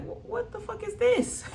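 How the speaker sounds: high-pitched mock bafflement; baffled. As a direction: imitate someone caught totally off guard. Acted quote of baffled reaction.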